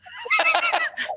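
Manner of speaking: a sudden high-pitched laugh. Real, not acted. Host laughs at the testicle twisting joke. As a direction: let a high giggle escape.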